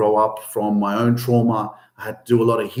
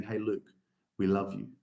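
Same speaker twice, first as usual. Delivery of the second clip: soft, much quieter loving words; tender parents; 2 semitones lower, 11 dB quieter than usual. Retold or imitated. Parents reassuring their son Luke, soft and tender. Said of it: "bringing me to them and saying".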